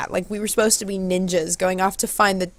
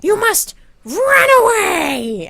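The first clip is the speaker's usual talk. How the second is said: high, loud, drawn-out cartoon voice; Spoons; 4 dB louder, slower than usual. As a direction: proclaim it shrieky and slow, in character. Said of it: "And Spoons goes".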